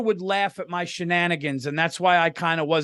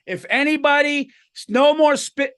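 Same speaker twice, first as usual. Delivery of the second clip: raised, higher-pitched teacher's scolding; mock yell, louder and pitched up; retold teacher yelling; 8 semitones higher, 4 dB louder than usual. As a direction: imitate a teacher yelling at the class. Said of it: "he's yelling at us".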